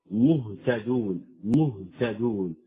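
slow مهتدون, clean vowelless ه; drawn-out model of مهتدون with a still ه; unrounded silent ha. Shown as a demonstration. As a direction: recite مهتدون slowly, lips released on the ه. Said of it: "لا تضم الشفتين".

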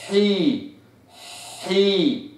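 حي from the breathy ح. Shown as a demonstration. Teacher drilling ح with kasra, breathy حي. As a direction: ease into حي from the breath. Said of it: "أو مكسورة".

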